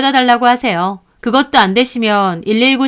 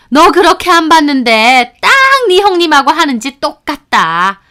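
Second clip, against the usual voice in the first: scolding mother-in-law; 3 semitones higher, 5 dB louder than usual. Retold or imitated. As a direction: scold her like a sharp-tongued mother-in-law. Mother-in-law's scolding text voiced. (며느리를 꾸짖는 시어머니, 크고 느린 호통)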